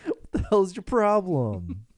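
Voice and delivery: high, loud exasperated whine; hammed-up indignant complaint; mock outrage. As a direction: play up being thrown off.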